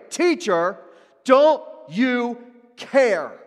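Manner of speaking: very loud, slow, high cry; harsh demand. Retold or imitated. Frantic disciples crying out to Jesus in the storm. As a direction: demand an answer, dragging each word. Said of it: "they're even harsh".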